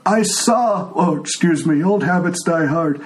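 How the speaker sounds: put-on a frail old man who can't hear; old blind tiefling. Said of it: "he says".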